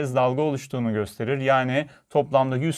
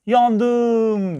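high, loud drawn-out cry; panicked shout; 8 semitones higher, 5 dB louder than usual. A narrator's reading. Little girl with a thorn in her foot, panicked shout. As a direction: yell it out high, stretched in panic. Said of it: "panikle bağırdı".

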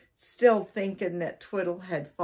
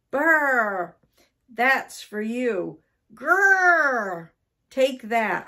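loud, higher growls and blows; angry growling; 6 semitones higher, 7 dB louder, much slower than usual. A narrator's reading. Husband attacks cats in the larder, loud angry growling. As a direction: growl it out, landing each hit. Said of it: "from the angry man".

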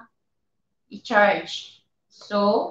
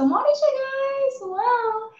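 excited; 13 semitones higher, much slower than usual. Real, not acted. Showing item to viewers.

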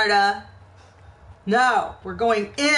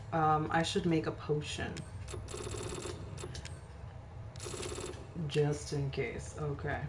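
quiet; 7 semitones lower, 7 dB quieter than usual. Real, not acted. Quiet exploring in dark.

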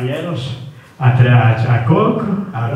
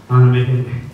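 usual speaking voice

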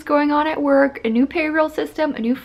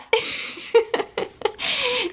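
high giggle; 12 semitones higher, 4 dB quieter than usual. Real, not acted. Laughs at her own joke.

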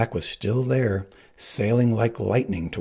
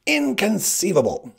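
very high, forceful cry of disbelief; outraged exclamation; 11 semitones higher, 4 dB louder than usual. A narrator's reading. Sicilian's outcry at the pursuer.

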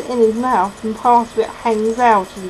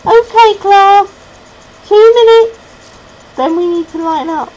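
teacher calling out; 10 semitones higher, 9 dB louder than usual. A narrator's reading. Teacher tells class to line up, calling out. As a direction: call across the playground to a class. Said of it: "A teacher's voice calls out".

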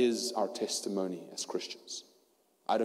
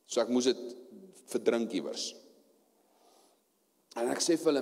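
ordinary talk, nothing marked

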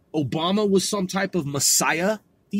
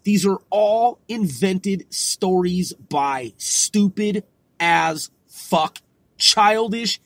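ordinary talk, nothing marked

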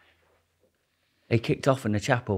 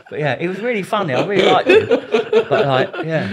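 laughing; 7 semitones higher, 7 dB louder than usual. Real, not acted. Laughing after the story.

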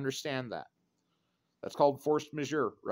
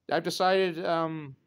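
normal talking voice

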